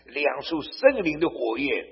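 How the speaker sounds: ordinary talk, nothing marked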